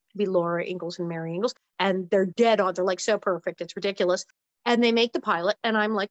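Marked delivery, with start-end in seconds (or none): none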